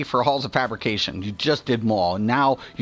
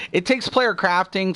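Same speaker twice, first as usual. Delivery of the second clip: ordinary delivery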